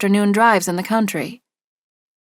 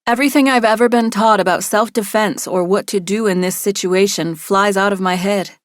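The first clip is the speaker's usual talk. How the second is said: ordinary delivery, nothing marked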